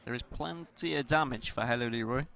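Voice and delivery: usual speaking voice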